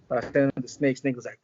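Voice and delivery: ordinary delivery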